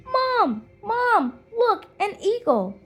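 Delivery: high, loud eager call to mom; a little girl shouting up to her mother; excited child calling. Acted. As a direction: point out the eagle, thrilled.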